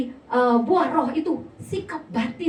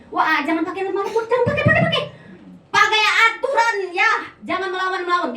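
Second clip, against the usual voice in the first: domineering leader; 7 semitones higher, 5 dB louder, faster than usual. Retold or imitated.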